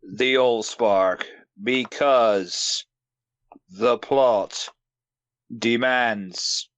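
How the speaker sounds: very slow, long pauses between phrases; overly serious, dragged-out delivery; halting Optimus speech. Acted. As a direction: draw out each word like a ponderous hero. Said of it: "the pausing".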